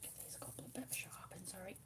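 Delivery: soft whisper over the colouring; hushed ASMR whisper. Acted. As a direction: whisper gently, as if not to break the calm. Whispered while sharpening.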